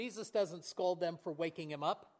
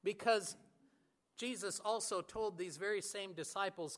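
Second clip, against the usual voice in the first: usual speaking voice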